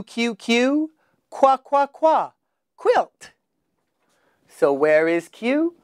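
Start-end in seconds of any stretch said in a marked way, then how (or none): 0.0-3.3 qua sounded out with a high, bright pitch before quilt; phonics drill. Shown as a demonstration. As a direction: model "qua, qua, qua" then lift high on "quilt". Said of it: "Say Q".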